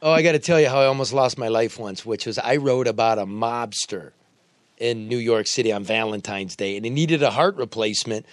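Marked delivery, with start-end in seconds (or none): none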